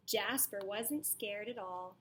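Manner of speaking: usual speaking voice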